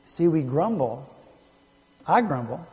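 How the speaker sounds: ordinary talk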